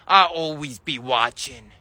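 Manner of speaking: low, slow, ominous warning; creepy threat. Acted. Creepy neighbour warns the boy, low and slow. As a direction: menace the kid with a low slow promise.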